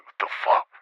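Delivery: high-pitched, incredulous "what the fuck"; retold exasperation. Phone won't stop ringing, retold irritated disbelief. Retelling Wu calling repeatedly.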